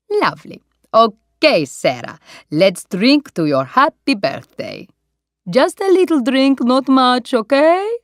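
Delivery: put-on jolly Italian owner with a broad Italian accent; Italian restaurant owner. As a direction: beam at the birthday guest, Italian style.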